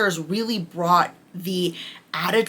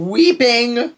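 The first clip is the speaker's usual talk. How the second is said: emphatic; 6 semitones higher, 8 dB louder than usual. Real, not acted. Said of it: "I cried again".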